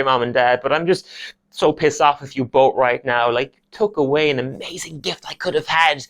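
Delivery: ordinary talk, nothing marked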